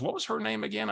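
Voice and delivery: a hint of a curious friend; Barbara's voice. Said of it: "I gotta use her voice".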